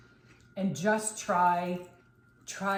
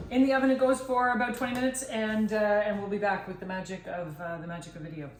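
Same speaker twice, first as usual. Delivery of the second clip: TV host sign-off; 4 dB louder than usual. Acted. Show host teasing the cut.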